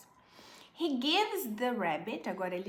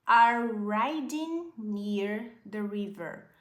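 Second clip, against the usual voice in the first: slow model reading; 5 dB louder, slower than usual. Shown as a demonstration. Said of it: "slow model reading".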